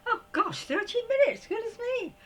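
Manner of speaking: taken aback by how late it is; real surprise. Real, not acted. Sees the time on clock.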